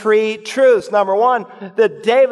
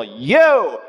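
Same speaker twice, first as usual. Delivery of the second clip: emphatic accusing shout; much slower than usual. Acted. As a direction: point the finger and shout you, David. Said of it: "it's emphatic in the original".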